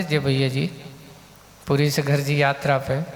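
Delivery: ordinary talk, nothing marked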